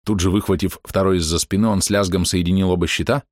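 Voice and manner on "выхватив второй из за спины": usual speaking voice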